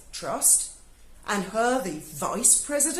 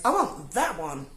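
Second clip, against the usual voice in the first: low, lightly voiced demanding bride; a touch of a spoilt, entitled ask; mocking Meghan; 5 semitones lower than usual. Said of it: "So when Meghan walks in and goes".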